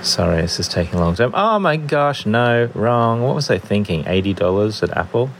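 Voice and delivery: ordinary delivery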